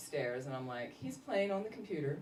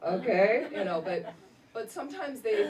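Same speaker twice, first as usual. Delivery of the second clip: normal talking voice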